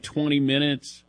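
ordinary talk, nothing marked

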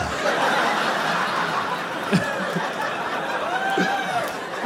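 very high breathless silent laugh; wheezing laugh. Real, not acted. Big laugh after punchline.

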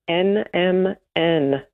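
very slow, evenly spaced letters. Real, not acted. Guest introducing a supplement's short name to the host, slowly. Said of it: "You have to say it slowly".

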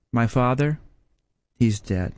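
ordinary delivery

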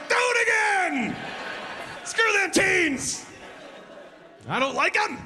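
high, slow, shouted cheer with laughter; cheering kid. Retold or imitated. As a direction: egg them on like a rowdy fan. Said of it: "I was the only one that was like".

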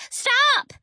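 sharp, high-pitched yell; yelled command. A narrator's reading. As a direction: yell to halt someone coming closer. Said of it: "but she yelled".

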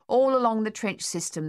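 usual speaking voice

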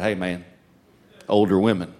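low, hushed aside to a buddy; cool boy confiding. Retold or imitated. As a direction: play it cool, deep and quiet. Confiding to his buddy about girls.

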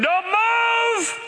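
high-pitched mock scream; screaming child. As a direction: shriek it like a panicking kid in the car. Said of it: "They start screaming".

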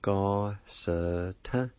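Ga-sa-tin drawn out very slowly; slow, careful Ga-sa-tin with a rise at the end; slow pronunciation demo. Shown as a demonstration. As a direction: stretch every syllable of Ga-sa-tin. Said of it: "it's pronounced like this".

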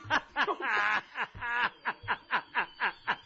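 a long laugh that fades out. Real, not acted. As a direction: laugh freely, then let it die down. Narrator laughs at the clip. (risa larga que se apaga)

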